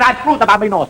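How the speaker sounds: lightly high comic Italian; Italian accent. Acted. Husband answering his wife in mock Italian accent. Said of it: "He said".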